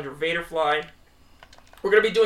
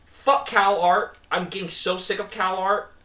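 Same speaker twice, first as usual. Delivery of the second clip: ordinary talk, nothing marked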